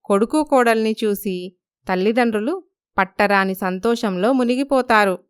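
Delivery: ordinary delivery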